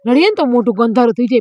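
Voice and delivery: ordinary talk, nothing marked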